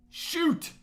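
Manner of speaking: startled yelp at the dead mouse; sudden exclamation. Real, not acted. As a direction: blurt Shoot when the mouse dies. Mouse suddenly dies mid-sentence.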